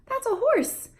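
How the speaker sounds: high, slow parentese labeling; high singsong toddler talk; modeling the answer. Shown as a demonstration. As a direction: show the child the answer brightly. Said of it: "you go".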